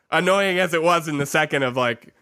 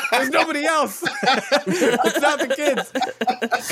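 laughing; 11 semitones higher, slower than usual. Real, not acted. Laughing while retelling own answer.

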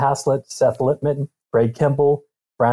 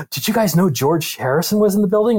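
mildly acted amazement, pitched up after a gasp; astonished wife; 5 semitones higher than usual. Husband retelling his wife's news about [name], mock astonished. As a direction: imitate a wife's gasped, incredulous question.

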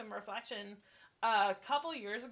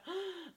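gasp; 8 semitones higher, 4 dB quieter than usual. Retold or imitated. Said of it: "And I went".

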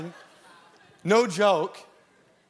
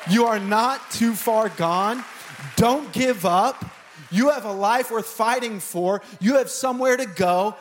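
fervent exhortation; 4 semitones higher than usual. Real, not acted. Preacher exhorting lost listeners.

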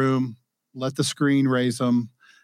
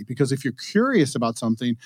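ordinary talk

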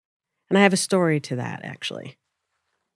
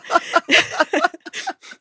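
high laugh; 10 semitones higher than usual. Real, not acted. Laughing at her own moaning.

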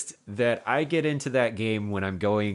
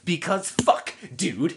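impression of Brad; 10 semitones higher, much slower than usual. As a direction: imitate Brad swearing. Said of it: "the one better where you're like".

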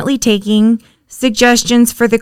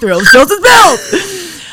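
monster truck announcer; 8 semitones higher, 4 dB louder, much slower than usual. Pretend. Announcer parody.

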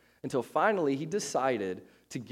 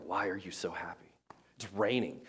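whispered mock disbelief; grumpy whisper; 4 semitones lower, 5 dB quieter than usual. To the too-happy girl in class, grumpy whisper. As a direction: whisper it as a private grumble.

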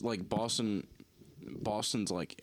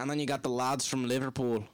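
higher, louder mock Scouse accent; mock Liverpool accent; 5 semitones higher, 4 dB louder than usual. Imitating British regional accents.

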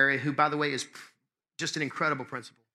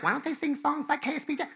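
high, put-on voice of a complainer; complaining listener; 11 semitones higher than usual. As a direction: imitate a whiner in a much higher voice.

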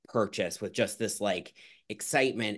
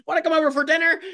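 high, louder, singsong offer; mock naive invite; 9 dB louder, faster than usual. Mocking herself inviting an Instagram stranger, gushing singsong. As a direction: play the gushing, too-trusting host. Mocking own over-trusting self.

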